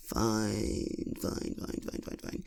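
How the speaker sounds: fading resigned fines. Acted. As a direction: give in, fading away to nothing. Giving in to shopping request.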